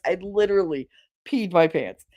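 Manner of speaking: ordinary talk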